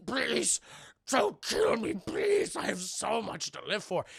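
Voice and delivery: high, strangled-sounding plea for mercy; mock begging. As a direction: beg for your life, hammed-up. Gaston begs for his life.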